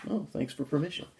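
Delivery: mock gratitude, thrown away under the breath; sarcastic aside. Real, not acted. Reader mocking the book's permission to spit, dry and sarcastic.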